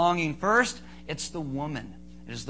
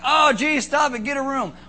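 high, loud mock-disgusted kid; protesting kids; 7 semitones higher, 7 dB louder than usual. Preacher as kids watching parents kiss, mock disgust. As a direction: imitate kids loudly objecting to their parents.

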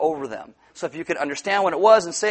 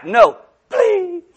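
high, loud mock pleading; hammed-up childish plea; begging child; 10 dB louder than usual. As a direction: beg like a kid working on mom. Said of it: "you when you're growing up with your mom".

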